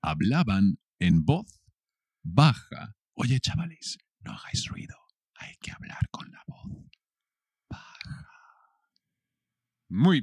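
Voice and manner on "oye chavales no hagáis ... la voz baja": hushed whisper to the lads; sinking into a barely audible whisper; low voice. Shown as a demonstration. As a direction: whisper it very softly, urging them to keep it down. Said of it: "in a low voice".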